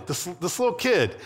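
ordinary talk

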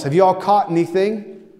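high, loud call across the water; calling out from shore. Retold or imitated. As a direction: shout to the boat from the beach. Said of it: "And the man yells".

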